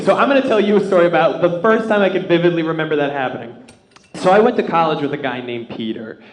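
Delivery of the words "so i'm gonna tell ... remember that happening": ordinary delivery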